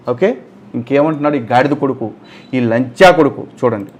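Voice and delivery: ordinary talk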